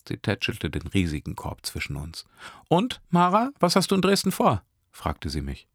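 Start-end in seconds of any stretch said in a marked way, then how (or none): 2.7-4.6 put-on much higher, cheerful young woman; sweet Nesrin. A narrator's reading. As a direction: offer the treats warmly and brightly. Nesrin asks Mara. (Nesrin bietet Brötchen an, viel höher)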